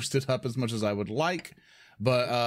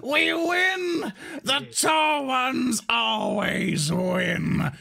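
high, slow gloating villain; mad scientist; 12 semitones higher, much slower than usual. Acted. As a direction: cackle it out madly. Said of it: "I am cackling madly at this point".

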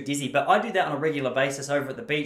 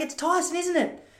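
much higher, sing-song lady voice; chirpy old lady; 14 semitones higher than usual. Retold or imitated. Old lady greeting him at the beach, high and chirpy. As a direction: squeak it like a delighted old lady. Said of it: "she's like".

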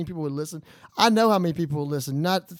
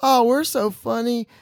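mocking smug passengers; 5 semitones higher, 7 dB louder, slower than usual. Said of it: "they were like".

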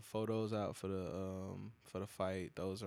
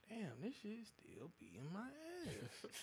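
pained groan; 10 semitones higher, 12 dB quieter than usual. Retold or imitated. Sick at work, aching.

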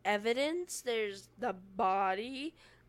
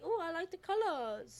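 airy; 8 semitones higher than usual. Pretend. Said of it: "I'd be like".

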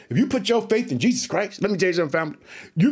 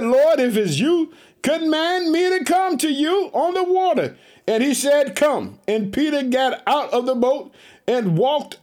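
raised, measured preacher's scripture read; 5 semitones higher than usual. Acted. Preacher reading the Gospel aloud to his church, raised and measured. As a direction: lift the Bible verse up to the congregation.

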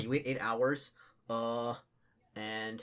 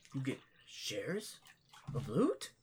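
orc voice; 3 semitones higher, slower than usual. Acted. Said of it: "he's like".

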